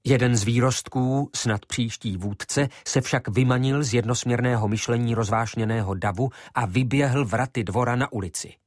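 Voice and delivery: usual speaking voice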